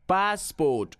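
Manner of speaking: passport split into two stretched syllables; pronunciation drill. Shown as a demonstration. Trainer leading pronunciation exercise, passport drawn out and exact. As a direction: stretch both halves of passport.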